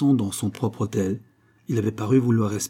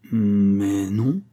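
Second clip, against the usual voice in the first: hesitant; 3 dB louder than usual. A narrator's reading. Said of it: "avec hésitation".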